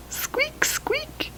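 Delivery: very high whispered squeaks; tiny breathy mouse squeaks. Pretend. Showing the child the mouse, whispered high squeaks. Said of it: "here's our little mouse".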